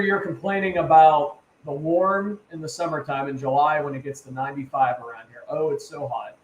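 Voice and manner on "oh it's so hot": ordinary talk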